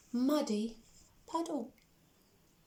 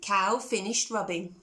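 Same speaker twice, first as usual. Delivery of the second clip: ordinary delivery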